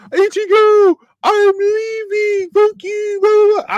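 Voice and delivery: very high, loud falsetto; exaggerated falsetto announcement; mock character outburst. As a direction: squeal it out slowly in a silly mocking voice. Imagined Uryu speech, mocking falsetto.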